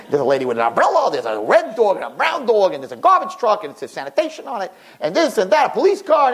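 lively sing-song rundown, pitch swooping high; animated retold window report; excited commentary. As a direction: rattle off the sights like a live commentator.

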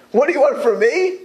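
high, loud, dismissive husband's reply; harsh retort. Retold or imitated. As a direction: brush off his crushed wife. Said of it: "You answer a crushed lady".

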